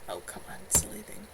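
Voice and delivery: whispered under the breath; hushed aside to herself, barely voiced; muttered aside. Real, not acted. Reader mutters at herself off the page, whispering.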